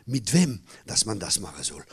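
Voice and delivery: usual speaking voice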